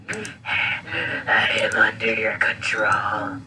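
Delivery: slow breathy zombie whisper; hypnotized whisper. Acted. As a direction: drone it like you're hypnotized. Hypnotized creature.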